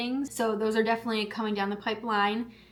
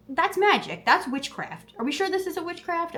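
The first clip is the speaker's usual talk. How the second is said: amazed; 8 semitones higher, 4 dB louder than usual. Real, not acted. Amazed at losing cookie cravings.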